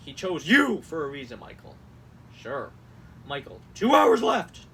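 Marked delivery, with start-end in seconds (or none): 0.5-0.8 YOU suddenly loud; all-caps emphasis. Acted. Host mocks a campaign text, shouting "you". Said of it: "certain things that, like, is being all caps". 3.7-4.7 drawn-out loud deadline warning; all-caps shout. Acted. Host reads a donation text, shouting the capitals. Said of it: "certain things that, like, is being all caps".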